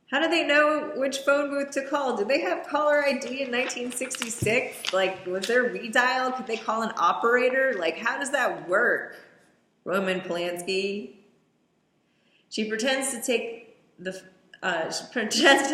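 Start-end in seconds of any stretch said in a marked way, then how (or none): 0.1-11.1 incredulous rant. Real, not acted. Ranting at film logistics.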